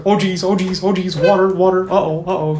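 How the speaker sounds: hammed-up panicky yelping; mock panic. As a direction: imitate a man flailing in the water. Acted panicking swimmer in retold video.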